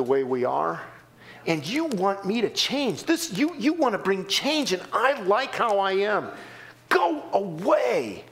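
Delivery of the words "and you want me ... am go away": sinner pushing God away. Retold or imitated. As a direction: dig in and tell him to leave. Voicing sinner refusing change.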